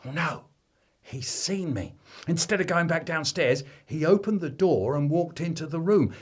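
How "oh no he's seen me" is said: ordinary delivery, nothing marked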